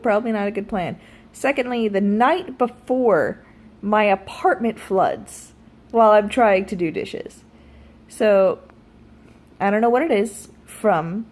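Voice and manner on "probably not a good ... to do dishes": ordinary talk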